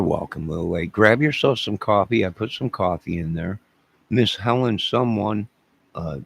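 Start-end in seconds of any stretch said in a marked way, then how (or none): none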